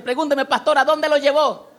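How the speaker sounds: high, loud, insistent question; eager to know. Shown as a demonstration. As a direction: ask as if you really need the answer. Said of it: "como quisiera, si quiere saber". (pregunta con ganas de saber)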